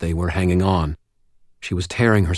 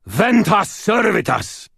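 booming, slow and high incantation; thundering spell; 13 semitones higher, slower than usual. A narrator's reading. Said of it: "thundering off my lips".

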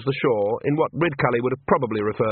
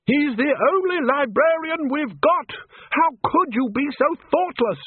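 very high, strained shout; angry shout. A narrator's reading. Arch-Chancellor scolds wizards over lost librarian, high shout. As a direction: shout a reproach, pitched way up. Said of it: "shouted Ridcully".

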